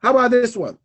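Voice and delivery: normal talking voice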